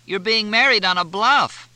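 loud, high teasing call; calling, laughing. A narrator's reading. Cindy laughing as she calls back to Fletch, loud. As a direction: shout the joke back, cracking up. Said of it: "doubled over in laughter".

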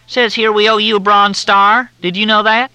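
high, loud, syrupy Southern drawl; Southern officer on the phone, in a thick drawl. Acted. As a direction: drawl it out like a courteous Southern major. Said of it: "The drawl was thicker than Mississippi mud".

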